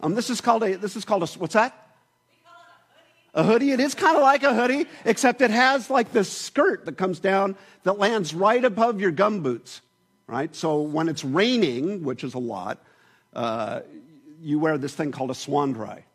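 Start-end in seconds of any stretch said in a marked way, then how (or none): none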